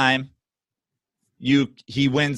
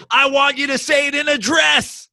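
high, hammed-up fan demand; absurdly insistent mock holler; mock demanding yell; 12 semitones higher, 3 dB louder than usual. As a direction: cry it out like a fanatic issuing terms. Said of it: "You're like".

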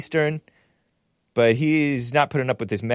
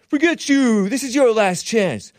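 shouting; 10 semitones higher, 3 dB louder than usual. Retold or imitated. Retold gunfight threat.

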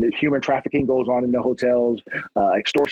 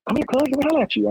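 drug dealer voice; 13 semitones higher, faster than usual. Retold or imitated.

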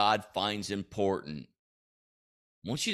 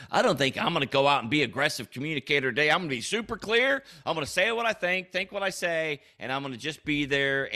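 higher-pitched, rushed mock resolve; blunt self-talk; 5 semitones higher, faster than usual.

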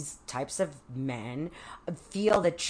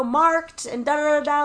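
high, loud mock rant; imitated scolding trailing into filler syllables; stepmother lacing into her; 11 semitones higher, 8 dB louder than usual. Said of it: "laced into me".

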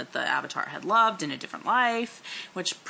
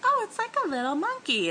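put-on much higher, curious kid voice; Aang voice. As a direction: play the naive boy, high and curious. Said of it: "'cause he's like".

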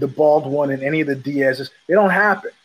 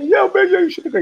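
very high, loud frantic answer; mock a freaked-out guy not catching on; imitated panic; 4 dB louder, faster than usual. Panicked man answering in story.

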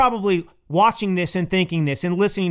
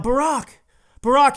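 mock yell of "Barack", the second call louder; retold yell; 6 semitones higher, 4 dB louder than usual. Biden calling out to Obama across the room, a mild mock yell. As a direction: call out "Barack" twice to get his attention. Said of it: "he yells out".

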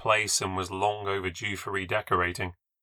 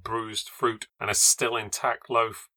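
plain reading voice

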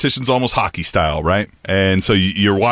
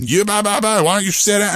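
higher, dismissive blah-blah imitation; mocking chatter; 6 semitones higher than usual. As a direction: mimic their nagging in a raised pitch. Mimicking the women's chatter.

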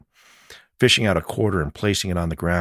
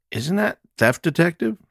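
teasing; 13 semitones higher than usual. Acted. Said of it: "Chance inquired, arching one of his eyebrows".

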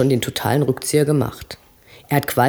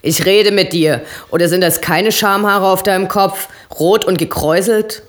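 higher and louder, jeering; yelling; 5 semitones higher, 4 dB louder than usual. A narrator's reading. Eddie keeps yelling insults at Obob. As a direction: keep roaring the insult. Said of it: "brüllt Eddie".

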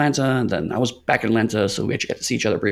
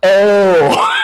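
loud, much higher shouted oh; stunned realization; 9 semitones higher, 10 dB louder than usual. Retold or imitated. To panelists naming their storyteller, loud stunned oh. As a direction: blurt it out as the penny drops.

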